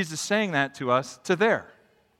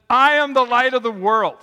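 bold proclamation; 5 semitones higher, 6 dB louder than usual. Acted. Preacher voicing Jesus' bold claim.

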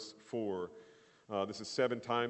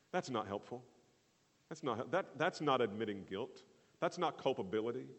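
ordinary talk, nothing marked

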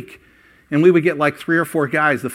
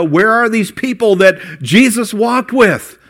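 bewildered searching question; 7 semitones higher, 5 dB louder, slower than usual. Pretend. Imagined stranger reading the Bible.

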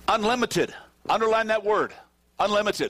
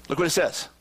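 ordinary delivery

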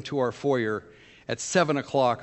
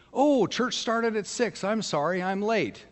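high, slow, apologetic traveler voice; voicing Canyon; 10 semitones higher than usual. Canyon the traveler apologizing to the pastor, high and slow. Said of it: "and he said to me".

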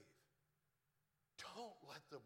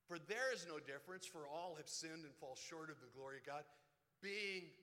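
scripture reading; 6 dB louder than usual. Acted. Reads Romans aloud from pulpit.

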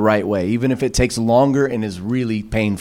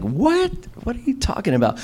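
high startled squeak, then quieter disbelief; shocked what; 12 semitones higher, 5 dB quieter than usual. Retold or imitated. Pastor retelling his shock at the phone call, squeaking in disbelief. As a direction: react like bad news just hit you.

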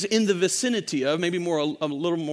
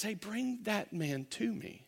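gentle summons; 13 dB quieter than usual. Acted. Jesus calls for the man.

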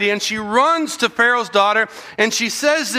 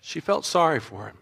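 hushed and heartfelt, dropped soft; soft emphasis; 4 semitones lower, 6 dB quieter than usual. Shown as a demonstration. Preacher answering kids' question, softly and with feeling. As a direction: let it land quietly, with feeling. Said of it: "tell me, what happened to Pharaoh's daughter's heart".

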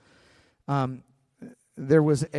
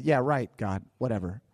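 lower, softer, dismissive scoff, quick at the end; mock-sarcastic Abram, underplayed; eye-rolling sarcasm; 6 semitones lower, 3 dB quieter, faster than usual. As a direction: roll your eyes and brush God off. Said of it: "rolled his eyes and said".

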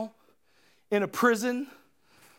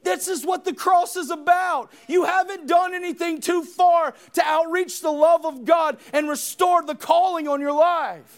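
loud, high preaching cry; full-force preaching; 7 semitones higher, 4 dB louder than usual. Acted. Preacher shouting to the congregation about the cross. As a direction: preach it out to the whole room.

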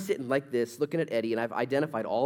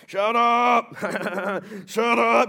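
loud, higher mocking yell; drunk heckler yelling; 9 semitones higher, 10 dB louder, much slower than usual. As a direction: jeer at the preacher from the bar table. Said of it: "and then yelling".

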